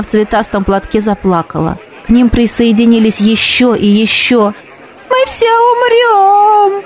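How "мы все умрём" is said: much higher, slow hysterical wail; hysterical scream. Acted. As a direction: panic in the crowd. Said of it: "истерично закричал кто-то". (истеричный крик, выше и медленно)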